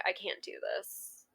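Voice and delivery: breathy, hushed retelling of a despairing line; barely voiced, as if losing hope; retold near-whisper. On the beach, telling him she can't go on, hushed.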